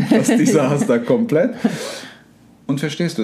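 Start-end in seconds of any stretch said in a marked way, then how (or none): 0.0-1.5 real laughter breaking into the words; laughing. Real, not acted. Speaker joking about the law of attraction on top, laughing. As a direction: finish the joke through a laugh, pitched up. Laughs while finishing the joke.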